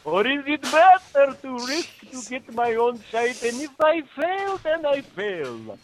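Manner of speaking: high, hammed-up Transylvanian Dracula; vaguely Bulgarian Transylvanian count; Transylvanian vampire accent. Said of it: "Brad requested it in Transylvanian, uh, vampire".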